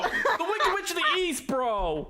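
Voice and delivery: high mocking squeal of her words. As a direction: echo her line in a shrill squeal. Mocking friend's line.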